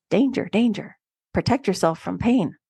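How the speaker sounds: ordinary talk, nothing marked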